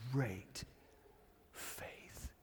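very soft, fading into a whisper; awed whisper. Acted. Disciple echoing what Jesus said to the woman, awed whisper. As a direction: breathe it out with long pauses.

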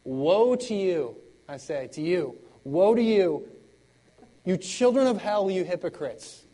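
loud, slow, thundering woe; mock hellfire preaching. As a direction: ham up a fire-and-brimstone pastor. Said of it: "Can you imagine I get up here".

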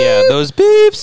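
high, loud, slow squeal; high squeaky voice. Pretend. Imitating censor beeps.

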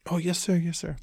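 high, eager yes-sir voice of a hotel clerk; eager desk clerk. Retold or imitated. Clerk hurrying to book the guest's ride, high and eager. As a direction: chirp it like a keen clerk eager to please.

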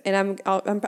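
tripping over the words; stuttering. Real, not acted. Podcaster losing the thread while talking about therapy, stuttering. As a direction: stumble through false starts, then break off. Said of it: "I'm stuttering, guys".

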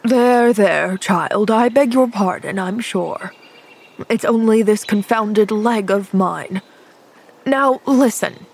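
lightly voiced older man, grudgingly apologetic; a hint of a cranky man calming down; softened gruff man. Injured man apologising to the girl, mildly softened.

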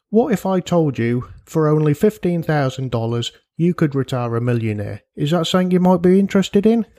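put-on oily, too-good-to-be-true hustler in a strange accent; slimy salesman. Podcast host pitching parents a millionaire retirement, slimy salesman. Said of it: "here's my slimy salesman, too good to ever be true pitch".

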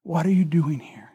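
slow, hushed whisper to the heart; soft whispered question, easing toward voice; gentle whisper. Acted. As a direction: whisper it softly and tenderly, drawn out. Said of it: "it's the whisper to the very heart".

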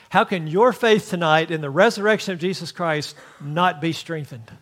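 ordinary talk, nothing marked

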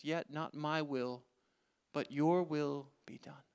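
soft, slow prayer of surrender; quiet surrender. Acted. Jesus to God in the garden, a soft slow yielding.

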